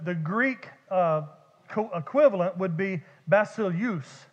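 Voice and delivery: normal talking voice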